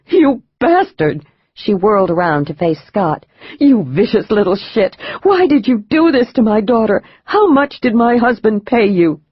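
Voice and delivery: panting rage at the doctor and the husband; furious shout. Acted. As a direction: scream it at him still panting. Mother lashes out after punch.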